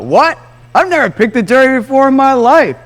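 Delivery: loud, high-pitched alarmed protest; retold panicked disbelief. Newbie told he's picking a jury, panicked disbelief.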